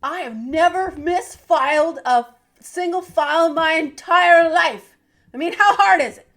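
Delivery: peppy cheerleader chant. Acted. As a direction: cheer the alphabet like a pep rally. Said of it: "God, I sound like a cheerleader".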